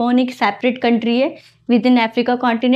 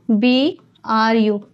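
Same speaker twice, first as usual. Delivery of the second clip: letters B R U said one by one, slowly; spelled out slowly; much slower than usual. Shown as a demonstration. Teacher spelling B R U slowly for the class. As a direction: spell out the mnemonic slowly for students. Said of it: "नीचे से लिखना है बी आर यू".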